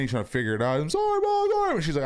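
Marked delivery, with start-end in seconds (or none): 0.8-1.8 put-on very high, wailing sorry like a sobbing child; crying kid. Dad imitating his son crying to his mom, high wail. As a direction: squeal it like a crying little boy. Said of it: "he's crying".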